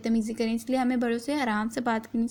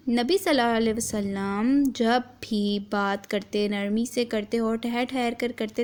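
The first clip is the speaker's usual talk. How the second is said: slow and measured. Shown as a demonstration. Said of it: "آرام آرام سے رک رک کے پڑھا رہی ہوں".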